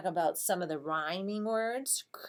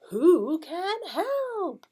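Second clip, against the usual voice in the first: "Who can help" high, loud and drawn out, falling at the end; singsong question intonation; 12 semitones higher, 9 dB louder, much slower than usual. Shown as a demonstration. Teacher shows the intonation to teach kids, "Who can help" as a long high singsong call. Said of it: "teach 'em that intonation".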